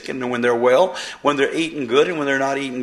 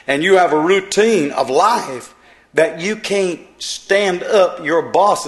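fiery preaching; 3 semitones higher, 3 dB louder, slower than usual. Acted. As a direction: build it up from the pulpit. Preacher builds to full force.

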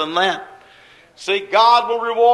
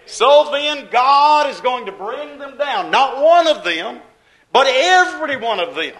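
fiery preaching; 4 semitones higher, 6 dB louder than usual. Real, not acted. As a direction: drive it home to the congregation. Preacher at full force.

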